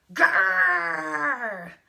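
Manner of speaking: loud, drawn-out growl; high, hammed-up bear roar. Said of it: "Then the bear jumped out".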